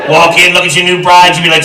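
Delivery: normal talking voice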